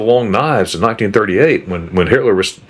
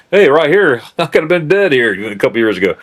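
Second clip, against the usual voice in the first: selfie joke; 6 semitones higher than usual. Retold or imitated. Tourist posing for selfies where he once could have been shot, laughing. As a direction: ham up a cheerful selfie with a laugh. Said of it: "now I'm sitting there posing, making selfies".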